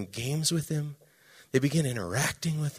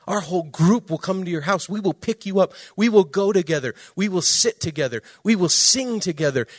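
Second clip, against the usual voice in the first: raised, louder rallying assurance; emphatic promise; 5 semitones higher, 6 dB louder than usual. Retold or imitated. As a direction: build a rhythmic pledge, hitting each phrase. Retold leader promises boy to accompany him.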